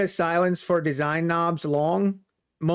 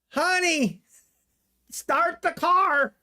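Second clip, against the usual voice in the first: higher, slow mock-urgent call to the wife; hammed-up escape order, drawn out; mock panic to wife; 9 semitones higher, slower than usual.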